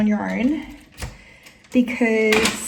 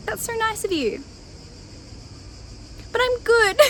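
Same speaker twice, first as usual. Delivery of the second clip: very high, retold polite brush-off; polite refusal; 12 semitones higher than usual. Solo traveler declining a drunk stranger's invitation, high polite refusal. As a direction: re-enact a high-pitched thanks-but-no-thanks.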